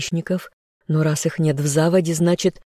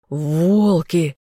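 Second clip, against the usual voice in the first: growled. A narrator's reading. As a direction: growl the word out. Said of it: "прорычал".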